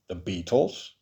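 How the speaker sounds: a touch of disdain, dropped low and soft; disgust. Acted. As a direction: scoff at the name. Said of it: "Reed said with disgust".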